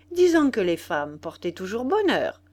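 usual speaking voice

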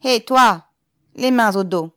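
loud, high-pitched shout at a prisoner; commanding yell; shouted order. A narrator's reading. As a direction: call out sharply and loudly. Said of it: "et lui cria".

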